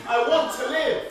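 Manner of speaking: desperate shout. Acted. Prisoner screams at his captor for freedom, loud and high.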